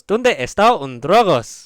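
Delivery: mock Spanish accent. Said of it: "he goes".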